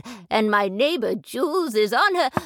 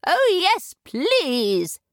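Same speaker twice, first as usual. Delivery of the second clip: eager yes; 6 semitones higher, much slower than usual. Acted. Jules eagerly agreeing to hear the story. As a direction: jump at the chance.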